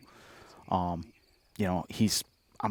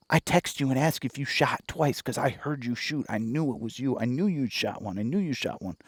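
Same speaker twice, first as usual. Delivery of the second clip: higher-pitched, insistent retelling; imitated excited brother; 6 semitones higher than usual. Brother who heard the shot, imitated excitement.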